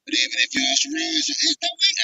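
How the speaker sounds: muffled phone audio, cutting out. Real, not acted. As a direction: sound muffled over a bad phone. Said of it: "you sound muffled, bruh".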